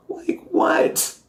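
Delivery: high breathless whispered what; incredulous whisper through laughter; disbelieving whisper. Real, not acted.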